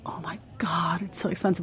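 whispered gasp. Retold or imitated. Retold thought about the cost.

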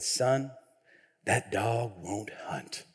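very low, slow, quiet Southern drawl; thick Southern accent. Retold or imitated. Father-in-law turning down his son's truck plan, thick Southern accent. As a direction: imitate a deadpan Southern father-in-law, deep and hushed. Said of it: "thick accent".

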